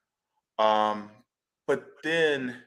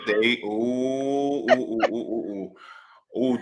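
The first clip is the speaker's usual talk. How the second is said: slow, stretched indignant ooh; real indignant oohs, drawn out slow; slower than usual. Real, not acted. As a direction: let the ooh build in outrage. Building outrage, drawn-out ooh.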